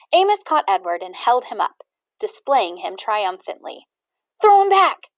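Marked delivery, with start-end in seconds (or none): 4.4-5.1 put-on loud, high boy's call; boy calling loudly to his brother. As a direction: shout across the deck. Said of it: "called Martin".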